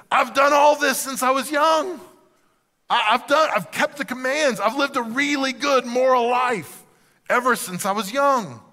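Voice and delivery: high, loud, earnest eagerness; excited young man. Acted. Young man telling Jesus he has done everything, excited. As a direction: insist on it excitedly, voice held high. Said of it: "He's so excited".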